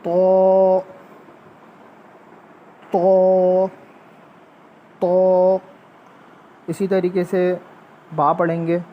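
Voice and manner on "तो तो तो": तो thick and louder, with a tongue tap; heavy ta demo. Shown as a demonstration. Teacher drilling children on a heavy, echoing तो. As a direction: repeat तो, thick and full. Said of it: "ज़बान के ऊपर वाले".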